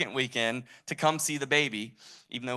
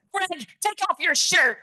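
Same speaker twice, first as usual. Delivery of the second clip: very high, loud, drawn-out yell; hammy high-pitched fitness guru impression; Richard Simmons; 7 dB louder than usual. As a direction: shriek the order way up high. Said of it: "I kinda do a decent impression".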